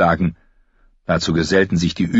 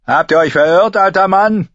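loud, high-pitched taunt; scornful lancer calling up, pitch raised; mocking; 13 semitones higher, 6 dB louder than usual. A narrator's reading. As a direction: jeer at an old man. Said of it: "spottete einer der Lanzenträger".

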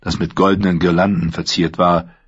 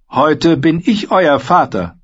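raised, slow royal proclamation; proclaiming king; 9 semitones higher, 3 dB louder, slower than usual. A narrator's reading. The king Joffrey to Sansa, grandly proclaiming. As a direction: announce it grandly as a boy king. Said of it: "verkündete er".

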